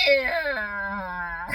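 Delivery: choked electronic screech, imitated; strangled squeal. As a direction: squeeze out a strangled shriek. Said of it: "It sounded like he was choking it to death".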